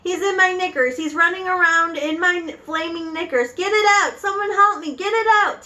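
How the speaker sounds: high-pitched panicky hollering; screaming. Acted. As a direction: plead frantically for help at the top of the voice. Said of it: "the man was screaming".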